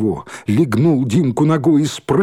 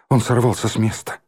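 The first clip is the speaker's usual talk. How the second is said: ordinary delivery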